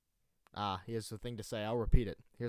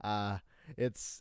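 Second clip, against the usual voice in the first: ordinary talk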